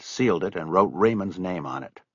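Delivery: plain reading voice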